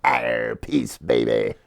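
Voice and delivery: very low growling pirate. Pretend. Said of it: "more like a pirate".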